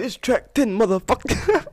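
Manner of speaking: high loud mock tough-guy line; Samuel Jackson. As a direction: bark it like an action movie star. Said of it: "I'm gonna get Samuel Jackson to do it".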